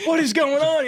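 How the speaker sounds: high, loud bewildered cry; excited disbelief. Retold or imitated. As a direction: shout it, totally thrown. Confused excitement during hunt.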